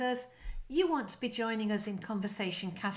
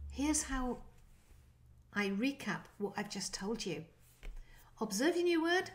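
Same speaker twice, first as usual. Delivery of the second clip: normal talking voice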